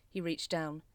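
plain reading voice

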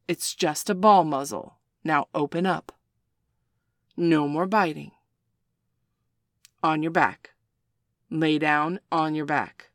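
loud stern orders; loud firm dog commands; commanding. Acted. Commanding the dog after the bite.

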